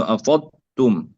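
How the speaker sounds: أفضتم with ض merged into ت; أفضتم with no separate ض sound; wrongly merged dad. Shown as a demonstration. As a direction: run the ض of أفضتم straight into a doubled ت. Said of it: "يدغم الضاد في التاء".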